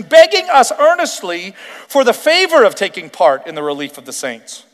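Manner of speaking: fervent pulpit reading; preaching. Acted. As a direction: preach it to the room. Preaching the scripture passage.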